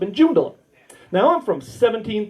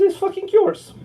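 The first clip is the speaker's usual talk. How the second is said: laughing punchline; 12 semitones higher, 3 dB louder than usual. Retold or imitated. Old man selling land, laughing high. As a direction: voice jumps high, laughing through it.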